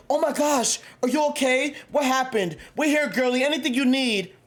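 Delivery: high mock-sympathetic girly voice; mock fussing girlfriend. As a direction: ham up a friend cooing over a drama. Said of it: "people who huddle around the girl".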